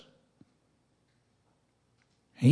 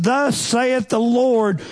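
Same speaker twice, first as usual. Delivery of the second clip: "Thus saith the Lord" dragged out very slowly, every word weighted; emphatic scripture quote; 9 semitones higher, 3 dB louder, much slower than usual. Real, not acted. Preacher to his congregation, slow heavy emphasis on "Thus saith the Lord". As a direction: lean hard on "Thus", then let it fall.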